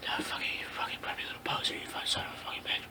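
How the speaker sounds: rapid hissed whisper under the breath; fast disgusted whisper. Acted. Vampire hissing insults at the preppy kid. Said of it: "he said it under his breath".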